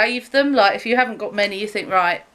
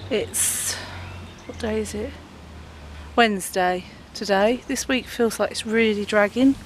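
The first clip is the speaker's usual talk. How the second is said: ordinary talk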